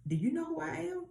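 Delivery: high, quick questioning as Moses; protesting Moses. Acted. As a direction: plead doubt with the pitch jumping up. Acting Moses doubting himself to God.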